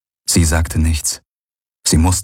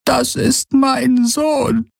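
much higher, slow, tearful; mother names her wounded son in tears; much slower than usual. A narrator's reading. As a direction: choke the name out slowly. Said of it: "Tränen liefen in ihren Pelz".

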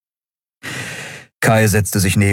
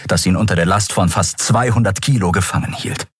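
plain reading voice

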